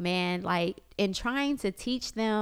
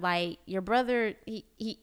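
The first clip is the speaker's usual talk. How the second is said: ordinary talk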